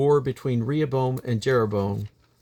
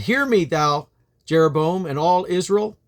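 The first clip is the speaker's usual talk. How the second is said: raised, higher voice calling across to an army; proclaiming from a mountain; 6 semitones higher, 3 dB louder than usual. Acted. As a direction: call it out like a king addressing the enemy host. Abijah calls out from mountain.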